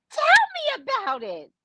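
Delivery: high-pitched exasperated agreement; exclaiming. Acted. Comic exasperated reaction.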